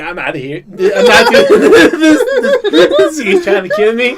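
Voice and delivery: high, laughing through every word; laughing Trump impression. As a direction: squeal it out while cracking up. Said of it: "He's like".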